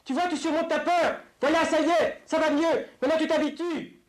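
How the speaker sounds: père qui crie à Jean-Louis, voix très aiguë et forte; le papa qui crie. Retold or imitated. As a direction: imiter le père qui hurle sur son petit. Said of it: "C'est le papa qui crie". (le papa crie à Jean-Louis, voix très haute)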